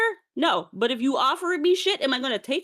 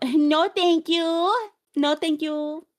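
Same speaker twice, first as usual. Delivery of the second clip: high, drawn-out, put-on "no thanks"; mock polite refusal; 6 semitones higher, much slower than usual. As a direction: decline in an exaggerated, higher-pitched voice. Acts out declining a weird surprise.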